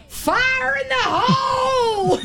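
high laughing yell; shouted. Pretend. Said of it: "yell as loud as you can".

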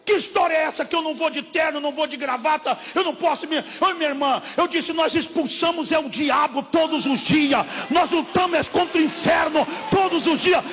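loud, high shouting sermon; fiery preacher's shout; preaching at full force. Acted. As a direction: thunder it at the congregation.

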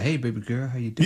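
low, softened sweet-talk to his partner. Acted. Co-host mimicking a player with his wife, low mock sweet-talk. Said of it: "And then with Sierra".

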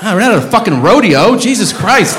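high, loud exasperated outburst; aggressive exasperation. Real, not acted. Comic ranting about a whistler, high and exasperated. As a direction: vent at the top of your range. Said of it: "that was a little aggressive".

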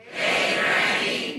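slow, drawn-out Hey, granny; audience call. Shown as a demonstration. Audience member calls out to the comedian, slow "Hey, granny".

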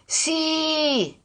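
long, high, drawn-out yes; far-away teenage yes, stretched out; sighing reply. A narrator's reading. Son answering about homework, sighing reply. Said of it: "arrivò come un sospiro".

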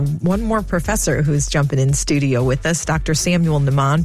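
ordinary talk, nothing marked

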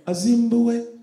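high, loud mock-African chant; crank caller. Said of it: "Then they start crank calling him".

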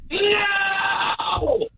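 very high, piercing sustained yell; long full-blast shout from across the room; record-attempt shout. Shown as a demonstration. Record holder yelling from metres away from the phone, piercing shout. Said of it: "Now, now you're testing me".

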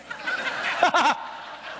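breathy chuckle at his own joke; a short airy laugh. Real, not acted.

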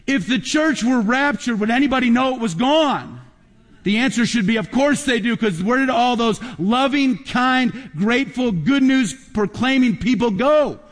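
climbing sermon fervour, drawn out; impassioned preaching. Acted. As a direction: lift the room with each word. Preaching climax to the congregation.

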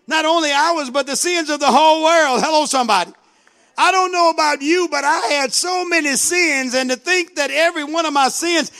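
full-voiced praise building to shouted hallelujahs; fervent preaching. Real, not acted. Preacher praises God for washed-away sins, fervent. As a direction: drive it up into loud, rousing testimony.

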